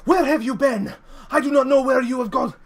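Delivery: loud, high, frantic scolding; panicked angry shout at a missing friend; freaking out. Acted. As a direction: shake him and yell where he's been. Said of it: "she's freaking out".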